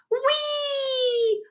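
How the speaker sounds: very high sliding squeal; gleeful whee. Acted. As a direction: ride the sound down the slide. Sliding down the ice slide.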